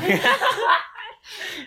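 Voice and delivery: shrill burst of laughter; high squealing laugh. Real, not acted. Laughing at joke.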